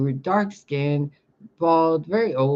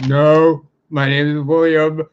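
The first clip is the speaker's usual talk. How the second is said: mock manly brush-off; 8 dB louder than usual. Posing as a man to cashier.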